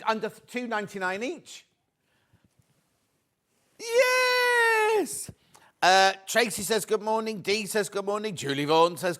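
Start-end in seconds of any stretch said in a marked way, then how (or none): 3.8-5.3 very high, loud, drawn-out "yes!"; long high victory whoop; triumphant cheer. Real, not acted. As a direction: squeal it out in celebration. Celebrates the low price.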